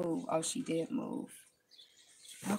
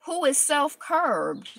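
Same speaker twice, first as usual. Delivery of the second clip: slow solemn reading; 3 semitones higher, much slower than usual. Shown as a demonstration. Reading the Buddha's words aloud, slow and solemn. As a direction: read each word slow and weighty.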